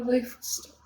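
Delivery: unvoiced broken whisper. Real, not acted. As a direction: barely breathe it. Whispers devastated.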